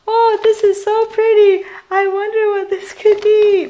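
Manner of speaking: high, loud, delighted gush over a present; excited gushing. Retold or imitated. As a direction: squeal over the pretty bag like a kid. Acting out opening the gift.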